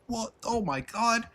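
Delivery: high, hammed-up fake shock; sarcastic squealing disbelief; mock surprise. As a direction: gasp it out like a shocked fan. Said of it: "You're like".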